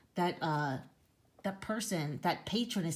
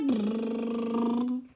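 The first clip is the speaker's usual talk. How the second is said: taxi engine noise; 5 semitones higher, 4 dB louder than usual. Acted.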